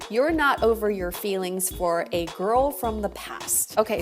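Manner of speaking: ordinary delivery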